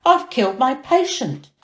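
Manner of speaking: doctor horrified at his fall; panicked cry. A narrator's reading. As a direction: blurt it out in panic. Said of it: "he cried".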